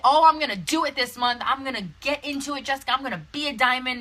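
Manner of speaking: high, loud mock enthusiasm; mock eager promises. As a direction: imitate an over-keen recruit making big promises. Mimicking flaky team members' promises.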